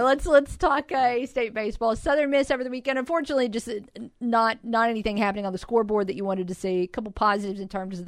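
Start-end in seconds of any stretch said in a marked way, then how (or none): none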